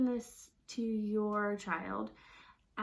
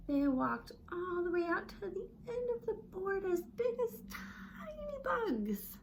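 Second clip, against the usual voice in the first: small voice; 7 semitones higher than usual. Shown as a demonstration. Teacher making the voice tiny for tiny bugs. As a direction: shrink your voice down to bug size. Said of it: "now we're gonna get small".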